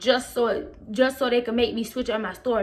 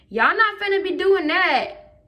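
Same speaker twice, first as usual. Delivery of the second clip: higher, drawn-out defiance; indignant refusal; 7 semitones higher, 4 dB louder, slower than usual. Retold or imitated. Student to staff about the cameras, indignant refusal. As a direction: put your foot down, stretching it out.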